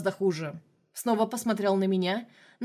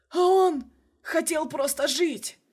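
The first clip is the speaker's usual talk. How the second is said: dying cry; 7 semitones higher, 4 dB louder, slower than usual. A narrator's reading. Dying man cries out.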